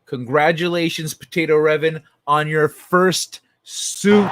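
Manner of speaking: loud, slow, drawn-out announcement; hammed-up celebratory announcement; grand congratulations. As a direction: proclaim it like a ceremony.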